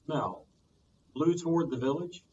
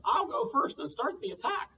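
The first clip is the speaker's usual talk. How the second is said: pheasant's voice; 10 semitones higher than usual. Acted. Pheasant speaking.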